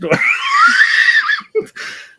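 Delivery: big burst of shouted laughter; loud laughing shout. Real, not acted. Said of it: "I thought I was fucking pissing myself".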